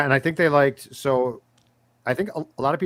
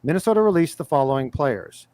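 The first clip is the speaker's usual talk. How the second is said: mock news anchor, louder and punchy; breaking news read; 2 semitones higher, 4 dB louder than usual. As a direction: announce it like a breaking news bulletin. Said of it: "fire up the breaking news sounder".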